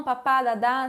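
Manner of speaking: ordinary talk, nothing marked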